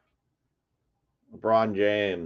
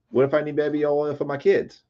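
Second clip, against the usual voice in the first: held high all through, rising at the end; high-pitched protest; 7 semitones higher than usual. Acted. Breaker protesting he needs it for his kids, high-pitched. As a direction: plead it high, lifting into a question. Second take, higher mock protest.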